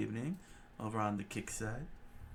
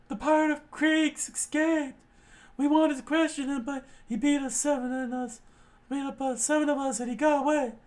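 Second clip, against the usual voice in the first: much higher, loud squeaky report; panicked marine; 8 dB louder than usual. Acted. Marine reporting the escaped pirate, high and panicked. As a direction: rush the bad news in a squeak.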